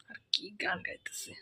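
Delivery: whispered aside. Acted. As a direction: mutter it so mother can't hear. Muttered aside about mother.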